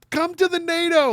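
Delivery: high ghost voice. Pretend. Ghostly voice calling Joe.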